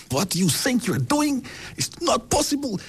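mother storming at the director; hammed-up indignant mother; furious mother.

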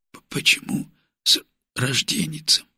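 soft, hoarse, stumbling words; numb lips. A narrator's reading. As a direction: struggle to move numb lips. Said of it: "с трудом шевеля непослушными губами".

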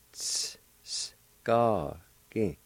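Ts, s whispered, then ga, gi voiced, each apart; split into sounds. Shown as a demonstration. Teacher breaking the word into its sounds. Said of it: "like this".

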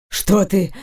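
plain reading voice

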